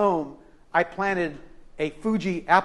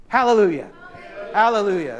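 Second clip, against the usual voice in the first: joyful hallelujah; 3 semitones higher, 4 dB louder, faster than usual. Real, not acted. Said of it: "That's what I say".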